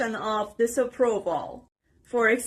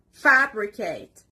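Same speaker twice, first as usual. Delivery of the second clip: "fabricate" stressed on FAB, drawn out slowly; stress on first syllable. Shown as a demonstration. Teacher modelling a word for learners, first syllable stressed. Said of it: "The stress is on the first part".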